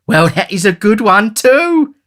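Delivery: high, slow, delighted lady; amused matron. Acted. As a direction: savour the joke.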